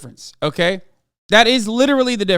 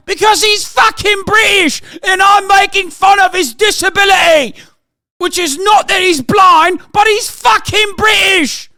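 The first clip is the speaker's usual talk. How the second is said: loud, higher mock-outraged rant; 8 semitones higher, 3 dB louder than usual. Real, not acted. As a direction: bellow it like a furious joke. Shouting rant about Britishness.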